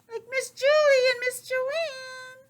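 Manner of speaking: put-on high, slow sing-song kid-show voice; singsong teacher. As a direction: chirp it brightly like a preschool host.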